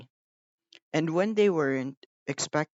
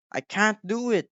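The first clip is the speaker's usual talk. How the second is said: sulky Gordon; 7 semitones higher, 3 dB louder than usual. A narrator's reading. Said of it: "said Gordon".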